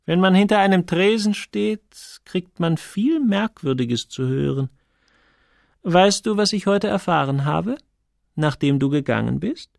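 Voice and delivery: a hint of a nosy confidante; lightly voiced Sonja, inquisitive. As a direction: lightly play a curious woman with gossip to share.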